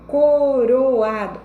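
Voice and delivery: coroado drawn out loud and slow, o and a separated; slow, strong teacher's coroado; vowels pulled apart. Shown as a demonstration. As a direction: stretch co-ro-A-do to split the vowels. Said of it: "separou as vogais".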